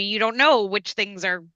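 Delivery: ordinary talk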